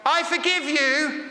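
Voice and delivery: high, drawn-out call across the room; acted yell from the back corner. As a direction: call it out to someone far away. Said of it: "in the back far corner yelled out".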